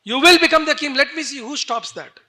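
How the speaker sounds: high, loud defiant shout; explosive angry challenge; out-of-control fury. Acted. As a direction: burst out, daring anyone to interfere. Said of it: "totally out of control".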